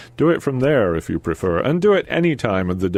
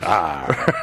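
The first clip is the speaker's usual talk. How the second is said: Devonian farmer; 5 semitones lower than usual. Pretend. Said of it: "Devonian farmer?".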